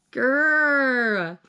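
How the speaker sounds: drawn-out exasperated "grr"; frustrated growl. Real, not acted. Reader without words for the ending, frustrated growl. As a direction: growl out wordless annoyance. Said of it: "I'm so mad".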